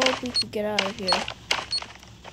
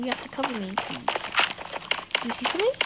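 usual speaking voice

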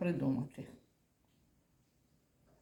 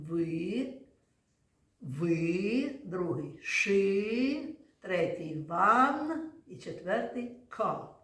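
slow, each syllable set apart; split into syllables; much slower than usual. Shown as a demonstration. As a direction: spell out ши, ван, ка one syllable at a time. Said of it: "поділимо на склади".

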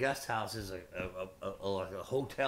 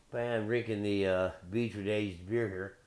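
normal talking voice

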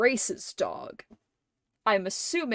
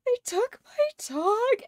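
high, cracking voice on the quote; imitating a tearful break, pitched up. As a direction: let the voice crack as the line comes out. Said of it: "and the voice breaks".

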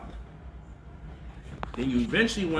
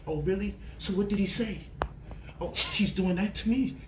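mock-interested, a touch higher; lightly hammed fake concern; 3 semitones higher, 4 dB quieter than usual. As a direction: pretend to care while not caring. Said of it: "to act a little concerned".

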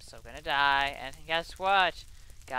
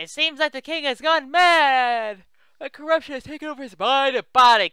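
dramatic quest read; 10 semitones higher, 15 dB louder than usual. Acted. Reads quest text aloud.

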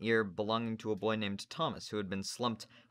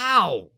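pained yelp; 10 semitones higher, 15 dB louder than usual. Acted. Boy yelps when cuffed.

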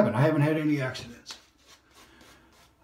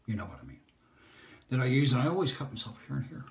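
normal talking voice

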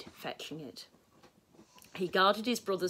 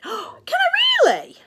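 high, loud, drawn-out squeal of delight; amazed, high-pitched excitement; thrilled; 11 dB louder, slower than usual. A narrator's reading. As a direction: react like an overjoyed little boy. Boy thrilled at knife offer.

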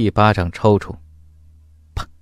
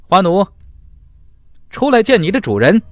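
arrogant command; 8 semitones higher than usual. Acted. Master summoning his slave.

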